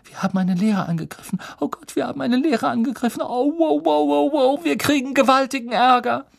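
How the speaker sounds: high-pitched, rapid fretting; squeaky, rushed alarm; panicked girl. A narrator's reading. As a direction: gabble in mounting panic, high and tight. Hermione panics after attacking teacher.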